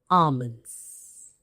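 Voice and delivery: "almonds" as AH-munz, silent l; correct pronunciation. Shown as a demonstration. Teacher wishing learners well, silent-l "almonds". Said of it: "get the pronunciation right".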